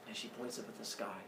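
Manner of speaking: ordinary delivery, nothing marked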